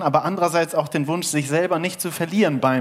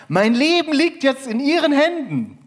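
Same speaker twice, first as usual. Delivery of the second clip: hoch und laut von unten gerufen; Fremder am Seil; 11 semitones higher, 4 dB louder than usual. Acted. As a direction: ruf eindringlich nach oben. Fremder ruft vom Seil. (eindringlich gerufen, hoch)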